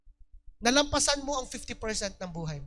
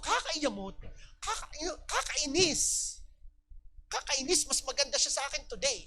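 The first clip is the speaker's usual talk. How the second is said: mock annoyance; 3 dB quieter than usual. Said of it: "Ako yun, isa ako".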